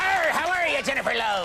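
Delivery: high, hammed-up pirate Irish; terrible Irish accent sliding into pirate; piratical Irish accent. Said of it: "my Irish accent is always a pirate".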